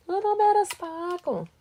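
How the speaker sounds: "Little bit of sparkle" repeated high and sing-song; playful echo. Shown as a demonstration. Crafter echoing her own line while adding glitter, playful high.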